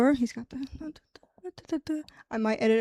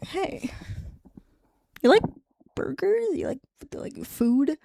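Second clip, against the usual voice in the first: a hint of a shy guy asking someone out, pitch jumping up; lightly voiced nervous Scott, voice leaping high on burgers; awkward flirty ask; 4 semitones higher than usual. Scott awkwardly inviting Kip for burgers, with a high nervous lilt.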